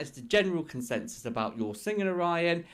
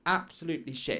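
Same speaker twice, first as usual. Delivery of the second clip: usual speaking voice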